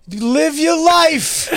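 high, long-drawn holler; clueless sideline cheer. Retold or imitated. Dad at Max's games who doesn't know what to say, hollering. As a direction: call it out slowly in a high mock cheer.

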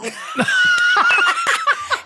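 high, loud burst of laughter; real shrieking laugh. Real, not acted. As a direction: crack up at the impression.

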